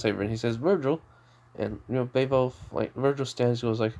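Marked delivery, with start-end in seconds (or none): none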